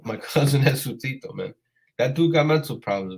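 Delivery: ordinary delivery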